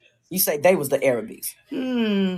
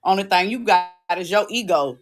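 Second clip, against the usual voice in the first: normal talking voice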